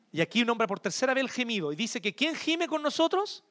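normal talking voice

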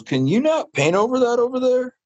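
high mimicked stranger asking a favor; imitating a woman. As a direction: pitch way up to mimic her polite ask. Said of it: "And she goes".

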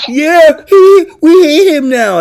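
high, loud mocking imitation; mock indignant crowd. Host mimics people upset about Columbus, high mocking voice. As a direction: mimic their outrage, pitched right up. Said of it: "They go".

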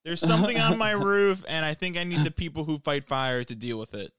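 high, loud mock panic about the roof; a pretend homeowner calling for help; mock panicked homeowner.

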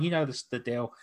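ordinary talk

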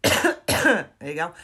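chesty coughing fit; loud hacking cough from a chest infection; real coughing. Real, not acted. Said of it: "Sorry about my coughing".

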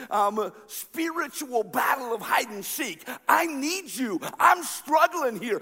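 high, loud, anguished shouting; desperate cry to God. Retold or imitated. David pleads with hiding God, anguished shout. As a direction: cry out to a hidden God, voice straining. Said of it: "David is saying".

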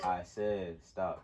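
slow deep barked order; barking gym coach. Pretend. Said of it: "There you go".

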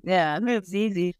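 smug, slow, offhand boast; lightly mocking a smug classmate who finished early; uppity. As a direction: mimic a classmate showing off. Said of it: "he was just so uppity".

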